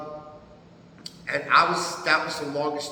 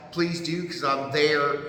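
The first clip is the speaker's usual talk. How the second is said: ordinary talk, nothing marked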